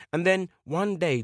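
ordinary delivery

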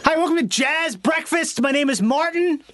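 high-pitched, hammed-up restaurant greeter; jazz brunch host. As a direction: put on a high, showy server voice.

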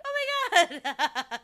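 high, loud delighted gasp; excited squeal. Retold or imitated. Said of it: "and I was like".

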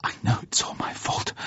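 whispered self-blame through tears; hushed, miserable admission; muffled, tearful. A narrator's reading. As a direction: breathe it out, barely voiced. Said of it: "she said in a muffled voice".

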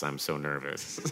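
ordinary talk, nothing marked

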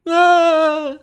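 high-pitched startled shriek. Real, not acted. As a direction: yelp out loud. Shrieks at gross joke.

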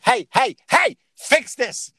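high, loud, stretched-out demand; mock baby demand. Imagining what a baby would say if it could, loud demand. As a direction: play the baby insisting someone fix it. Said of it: "can't go like".

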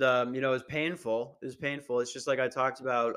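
ordinary talk

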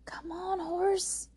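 high-pitched coaxing plea; urging. A narrator's reading. May May to the unmoving horse, urging it on. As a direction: urge a stubborn animal along in a high voice.

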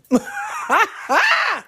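a sudden shrieking laugh, far above her voice; howling laugh at her own joke. Real, not acted. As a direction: burst out in a high cackle. Cracking up at own story.

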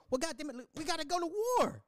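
high, fast hammed-up rally cry; mock war cry. Mocking a gung-ho 1940s American rallying for war. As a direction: pump up the crowd for war.

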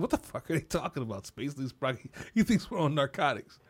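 low, soft imitation; lightly voiced confused aside; puzzled mom. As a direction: mutter in bafflement as an onlooking mom. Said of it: "the mom's like".

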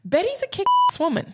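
high, enthusiastic praise; excited. Real, not acted. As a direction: cheer her on.

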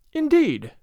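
INDEED high, then falling; exclaimed reply. A narrator's reading. Mother-in-law replies to the father's news, exclaiming with a fall. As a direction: exclaim "Indeed" from a high pitch, dropping away.

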